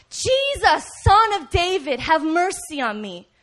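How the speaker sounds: high, loud plea easing at the end; yelling out. Acted. Blind beggar yelling to Jesus for mercy, loud cry. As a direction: cry out to be heard over noise. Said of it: "screaming and yelling out and crying".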